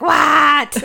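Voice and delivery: loud, high snap of a fed-up mother; hammed-up exasperated shout; mock exasperated yell. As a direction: yell it like a parent at breaking point. Exasperated mother snapping at kids.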